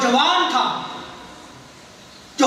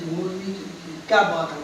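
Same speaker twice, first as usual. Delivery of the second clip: low, hushed mock grumble; mimicked dismissive aside; 6 semitones lower, 7 dB quieter than usual. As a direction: imitate a soft, low dismissive remark. Mimics private dismissal of clerics.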